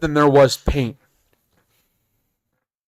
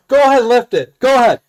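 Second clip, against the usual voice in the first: higher, louder mock challenge; goading dare; 7 semitones higher, 5 dB louder than usual. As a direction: egg him on loudly in a raised pitch. Daring someone to lift it.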